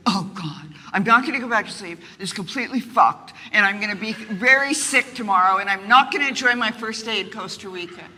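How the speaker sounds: loud, fast anxious rant; retold panic. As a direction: spiral into loud, hurried worry. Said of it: "I wake up at three thirty and go".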